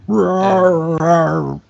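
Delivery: loud, drawn-out bear growl-roar. Acted. Asked what the bear does.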